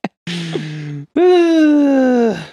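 loud, high, pained groan; exasperated groan. Real, not acted. Groaning at the film's badness.

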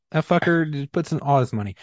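usual speaking voice

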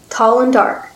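ordinary delivery

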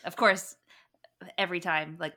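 normal talking voice

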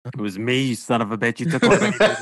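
hammed-up spite; gloating confession. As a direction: reveal yourself like a petty villain. Mom confesses egging, acted gloating.

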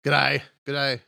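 loud, high mock Australian greeting; Australian accent. Shown as a demonstration. Cohost practising the accent, loud Australian accent. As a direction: belt out a put-on Australian hello. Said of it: "it's not that Australian".

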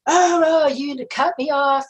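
imitating a man yelling at a driver; mock angry yell. Driver mimicking the angry man who yelled at her, mock shout. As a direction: retell his outburst in a half-hearted yell. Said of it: "he started yelling at her".

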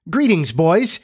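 high, lively greeting; hearty cry. Acted. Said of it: "he cried".

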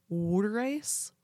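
"water ice" as "wooder ice"; rising "wooder ice" for "water ice"; Pennsylvania pronunciation. Shown as a demonstration. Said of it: "they say water ice".

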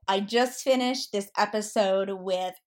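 ordinary delivery

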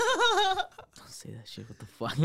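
high laughing objection; laughing protest. Real, not acted. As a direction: squeal it through laughter.

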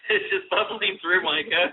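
talking through laughter; giggling teasingly; laughing. Real, not acted. Teasing a bored guest.

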